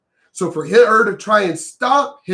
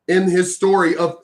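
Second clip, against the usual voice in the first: usual speaking voice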